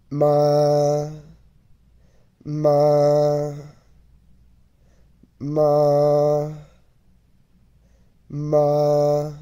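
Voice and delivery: "ma" held on a high, level pitch; high flat Mandarin tone on "ma"; first tone. Shown as a demonstration. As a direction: sustain each "ma" high and perfectly even. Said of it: "Let's take each one at a time".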